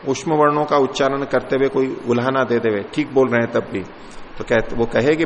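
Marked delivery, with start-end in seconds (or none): none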